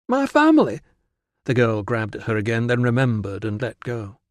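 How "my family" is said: high, loud outburst of alarm; appalled disbelief. Acted. Virana balks at Tilla meeting her family, appalled. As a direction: echo it back, aghast and shooting up in pitch.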